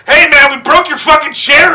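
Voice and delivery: loud, high mock yell; yelled confession. A wrestler yelling to the bar owner about the chair, loud. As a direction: shout it cheerfully at the owner. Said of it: "this dude went and had a".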